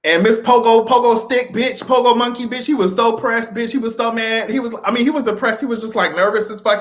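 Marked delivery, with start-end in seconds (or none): none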